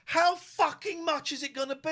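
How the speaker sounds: high, loud furious rant; angry yell. Retold or imitated. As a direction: bark it like a raging bloke in a queue. Angry man in queue.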